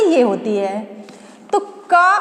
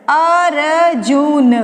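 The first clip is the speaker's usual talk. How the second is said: slowly sounded out; 3 dB louder, much slower than usual. Shown as a demonstration. Teacher to child, drawing out Arjun slowly. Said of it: "जा पे कौन सी मात्रा आएगी बेटा?".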